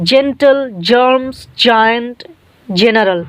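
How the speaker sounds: জেন্টল, জার্মস, জায়ান্ট, জেনারেল, each opening on a j sound; soft G demonstration. Shown as a demonstration. Teacher giving examples of the rule, জেন্টল, জার্মস, জায়ান্ট, জেনারেল with soft j openings. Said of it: "বর্গীর জ এর মতো উচ্চারণ হবে".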